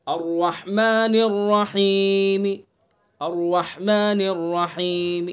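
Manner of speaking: slow recited الرحمن الرحیم with a faint final vowel; Quranic recitation. Shown as a demonstration. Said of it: "جیسے".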